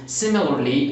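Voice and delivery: normal talking voice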